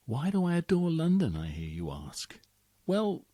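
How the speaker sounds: ordinary delivery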